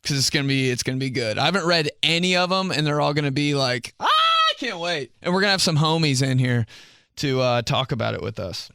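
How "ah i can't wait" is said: mock eager. Guests eager to share stories.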